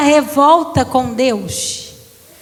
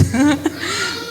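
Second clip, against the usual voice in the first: brief amused laugh; a quick real chuckle; light laugh at her own joke; short laugh; 5 dB quieter than usual. Real, not acted. Joke about her son.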